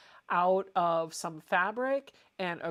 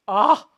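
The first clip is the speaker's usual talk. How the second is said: excited squeal; 3 semitones higher, 15 dB louder than usual. Real, not acted. Excited over fabric scraps.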